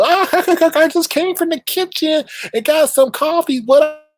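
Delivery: very high cackling mimicry; over-the-top squeaky, laughing host voice; silly mocking impression. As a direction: giggle and squeal it in mockery. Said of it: "you be saying something stupid".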